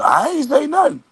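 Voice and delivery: high-pitched imitation of a man denying; squeal-high mock protest of innocence; mock denial. Confronted man denying his words, high mock denial.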